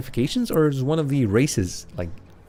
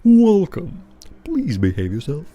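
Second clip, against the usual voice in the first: troll bouncer voice; 4 semitones higher, 6 dB louder, slower than usual. Shown as a demonstration. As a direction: play the hulking troll at the door. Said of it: "What kind of voice should you give him?".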